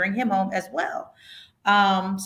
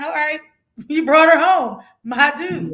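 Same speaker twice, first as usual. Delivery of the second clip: laughing and much louder and higher; 8 semitones higher, 7 dB louder than usual. Real, not acted. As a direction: laugh out a cheeky thanks to Biden. Laughing praise for Biden.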